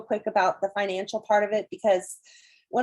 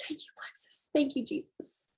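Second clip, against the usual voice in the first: breathed, much softer thanks; grateful whisper; 5 semitones higher, 10 dB quieter than usual. Retold or imitated. Thanking Jesus after the total, soft grateful whisper. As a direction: whisper it like a prayer.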